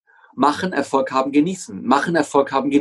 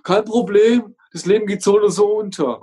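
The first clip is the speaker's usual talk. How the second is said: higher, put-on carefree voice; mock nonchalance; 5 semitones higher than usual. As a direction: wave the problem away in a lilting voice. Acted quote of careless person. (gespielt sorglos, mit höherer Stimme)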